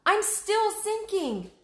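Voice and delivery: wailing. Acted. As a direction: stretch out a frightened wail. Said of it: "Annie wailed".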